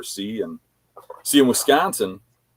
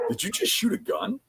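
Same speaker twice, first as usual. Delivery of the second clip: high, softer imitated disbelief; incredulous question; 5 dB quieter than usual. Campers confront the truck driver, imitated incredulous question.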